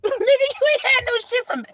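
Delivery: high, loud laughing retort. Real, not acted. Preacher laughing as he calls out a fake celebrity. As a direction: laugh through the put-down.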